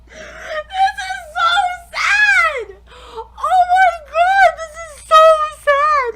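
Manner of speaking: slow, high, loud crying. Real, not acted. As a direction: sob it out, overwhelmed. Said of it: "I'm goddamn crying".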